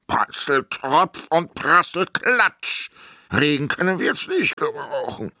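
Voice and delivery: grumbling giant. A narrator's reading. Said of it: "murmelte Simon".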